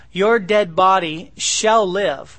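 loud, slow proclamation; proclaiming. Acted. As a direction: ring it out to the room. Proclaiming verse.

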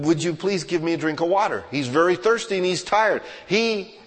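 the pastor drives the story home to the congregation with full-force preaching; fiery preaching. Acted.

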